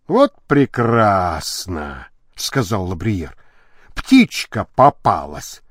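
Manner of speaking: low, slow, savouring the catch; gloating satisfaction. Acted. Довольный сыщик поймал беглянку. (низко, довольно, с растяжкой)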